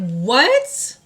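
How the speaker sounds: high, loud retold disbelief; imitated stunned outburst; shocked question. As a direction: blurt it in acted shock, rising at the end. Retold shock at friend's news.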